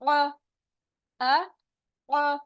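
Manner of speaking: अ pressed out from the middle of the throat as ain; throat ain sound अ. Shown as a demonstration. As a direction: squeeze अ from mid-throat. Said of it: "आइन की आवाज हलक से निकलेगी".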